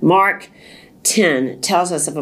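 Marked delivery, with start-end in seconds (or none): none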